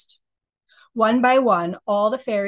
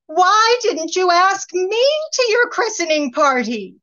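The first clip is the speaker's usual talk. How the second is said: high shrill-free loud outcry, far above the narrator; fairies crying out together; 11 semitones higher, 6 dB louder than usual. A narrator's reading. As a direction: fairies shouting their grievance. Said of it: "they all cried with one voice".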